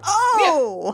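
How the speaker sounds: high, drawn-out goblin "oh"; long rising-falling exclamation, hammed-up; mock goblin realisation. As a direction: imitate dim minions finally getting it. Voicing goblins getting the joke.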